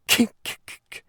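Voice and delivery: stifled snorting clicks; suppressed noises. A narrator's reading. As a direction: choke back little bursts of noise. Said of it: "making strange suppressed noises inside him".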